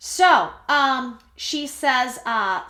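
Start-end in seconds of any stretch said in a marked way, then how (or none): none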